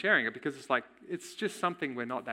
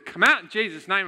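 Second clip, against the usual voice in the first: loud, higher command to come out; commanding shout; 5 semitones higher, 9 dB louder than usual. Retold or imitated. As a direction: order the spirit out with force. Mentor commanding spirit to leave.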